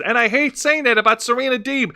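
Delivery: usual speaking voice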